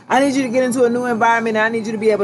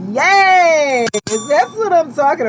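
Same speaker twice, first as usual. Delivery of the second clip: excited yes; 5 semitones higher, much slower than usual. Real, not acted. Reader cheers the timer sign, excited yes. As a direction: burst out happy at the sign.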